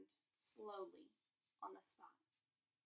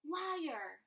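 a sudden shout, much higher and louder; 8 semitones higher, 11 dB louder than usual. A narrator's reading. Harry defies Voldemort, shouting. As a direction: hurl it back at him. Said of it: "Harry shouted suddenly".

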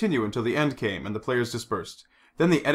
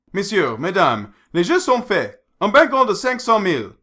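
raised, ringing French croupier call; casino croupier; 7 semitones higher, 6 dB louder than usual. A narrator's reading. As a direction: announce the bank to the table. Croupier announces the bank.

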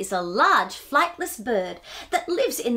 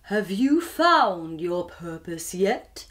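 put-on lower, slow, wise old owl voice; deep, slow owl; 6 semitones lower, slower than usual. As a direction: drag each word out like a sleepy night owl. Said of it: "in a voice that was deep and slow".

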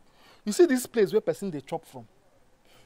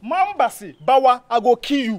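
loud, raised-pitch warning; heated, forceful telling-off; angry threat; 3 semitones higher, 7 dB louder than usual. Acted. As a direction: build louder into the threat. Threat in an acted quarrel.

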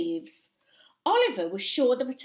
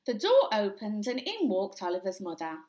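usual speaking voice

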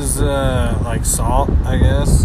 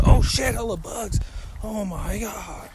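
higher, startled exclamations; 8 semitones higher than usual. Real, not acted. Vlogger startled by a swarm of bugs at the lake, higher. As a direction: jump back from a cloud of bugs.

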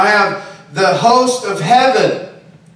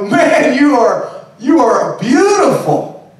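delighted praise; 4 semitones higher, slower than usual. Retold or imitated. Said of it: "I go".

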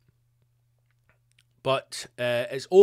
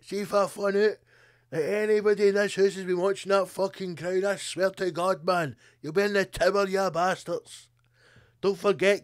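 higher, hammed-up menacing rant; mock threatening prince; 6 semitones higher, 3 dB louder than usual. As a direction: threaten the whole house like a tyrant.

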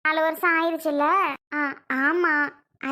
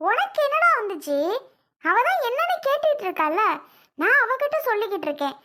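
much higher shrill-free yell, pitched way up; furious retort; 6 semitones higher than usual. Acted. Mother yells back at her son to get out of the way, pitched high. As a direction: snap back at him in rage.

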